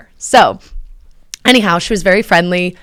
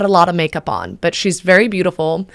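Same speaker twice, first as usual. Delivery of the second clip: normal talking voice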